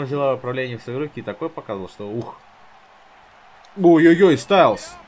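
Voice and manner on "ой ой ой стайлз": loud, high alarmed cry; excited shout. Real, not acted. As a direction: yell out at the big hit. Big hit in game.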